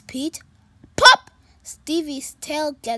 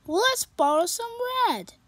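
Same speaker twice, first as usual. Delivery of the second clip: higher, louder bright cat voice; cheerful kitty voice; 9 semitones higher, 4 dB louder, faster than usual. Acted. Pete greeting his sad friend, high and cheerful.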